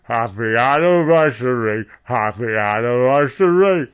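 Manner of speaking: loud, hammed-up repeated greeting; big put-on proclamation; mock celebratory. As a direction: belt out the greeting over and over.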